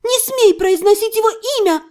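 high, heated shout; indignant high-pitched yell. A narrator's reading. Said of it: "заорала я".

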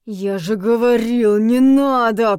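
slow, loud angry growl; angry rebuke, heavy and loud. A narrator's reading. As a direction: growl it out, drawing out the words. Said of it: "прорычал Винсент".

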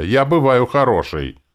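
high, loud self-defence; indignant. A narrator's reading. Hero defending himself to his copy, indignant protest. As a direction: insist on it loudly, indignant. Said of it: "возмутился я".

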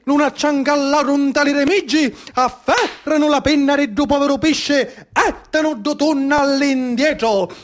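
rising theatrical narration, pushing through the rhythm; dramatic storytelling. Acted. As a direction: tell the slaughter like a stage epic. Epic retelling of the tuna slaughter. (racconto teatrale incalzante)

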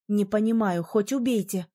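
ordinary delivery

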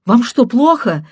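loud, high and insistent; abandoned wife shouting at the husband; desperate shouting. A narrator's reading. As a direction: plead loudly, near sobbing. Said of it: "кричала она".

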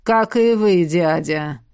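сипло. Acted. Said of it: "просипел Кортес".

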